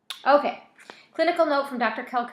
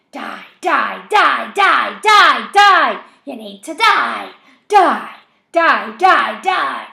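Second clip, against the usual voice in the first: loud high shrieking chant; frenzied screaming; 4 semitones higher, 17 dB louder, much slower than usual. A narrator's reading. Voice screams for death.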